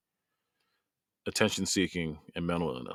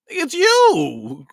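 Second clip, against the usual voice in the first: high, louder cry of "it's you"; imitating the mom; 13 semitones higher, 5 dB louder than usual. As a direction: voice the mother clinging to her daughter, pitched up. Mom hugging daughter, quoted.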